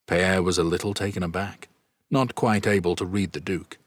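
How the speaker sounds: plain reading voice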